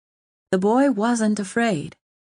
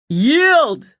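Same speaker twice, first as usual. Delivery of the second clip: much higher, louder demand; shouted command; 9 semitones higher, 7 dB louder than usual. A narrator's reading. Owen shouting down at the king, commanding. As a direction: hurl it back at him. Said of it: "Owen shouted back".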